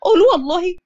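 high-pitched mild disbelief; lightly acted incredulity, pitch up; disbelieving. A narrator's reading. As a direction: demand it as if you can't quite believe it. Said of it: "مريم بعدم تصديق".

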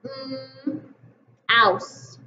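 mm held then ouse; segmented sounds. Shown as a demonstration. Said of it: "word is".

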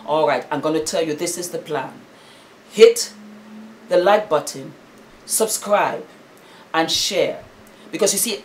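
usual speaking voice

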